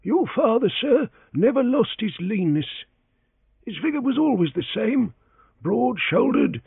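high, deferential servant voice; humble old valet answering his master; elderly valet Parker. A narrator's reading.

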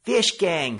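high, loud panicked cry; frightened warning yell; terrified cry. Acted. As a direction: shout it like you're fleeing in terror. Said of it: "terrified cries of".

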